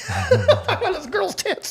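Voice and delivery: words squeezed out through laughter; laughing. Real, not acted. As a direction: laugh while you say it. Laughing through his own story.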